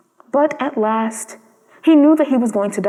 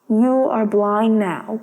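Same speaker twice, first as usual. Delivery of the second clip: slow, tender and lower; tender swallow; 3 semitones lower, much slower than usual. Acted. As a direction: say it gently, slowly, to a friend. Swallow speaks to blinded prince.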